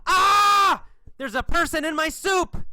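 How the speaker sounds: high, loud storybook scream; horrified yell. Acted. Bug finds a person in his soup, yelling in horror. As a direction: shriek it high in shocked disbelief. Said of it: "He yells".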